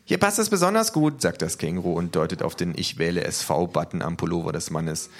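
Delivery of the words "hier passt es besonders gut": high kangaroo voice; eager kangaroo. Acted. Kangaroo placing a sticker, high-pitched. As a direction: chime in brightly, pitched way up.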